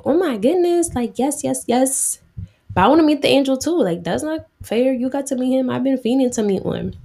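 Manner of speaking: excited husband. Retold or imitated. Husband hears they'll have a son.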